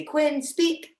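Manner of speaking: high, bright call to the dog; "Quinn, speak." said high and rising; dog command. Shown as a demonstration. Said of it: "I have to say".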